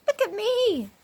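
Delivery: high, delighted call; a flying girl's joyful cry; excited cry. Acted. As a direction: show off with joy. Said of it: "she cried".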